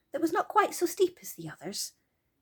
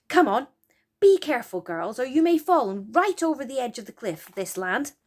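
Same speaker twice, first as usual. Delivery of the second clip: louder, eager call to go; excited urging; 3 semitones higher, 6 dB louder than usual. Acted. Joe leading the girls down the cliff, excited. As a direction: hurry the girls on, thrilled. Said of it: "said Joe excitedly".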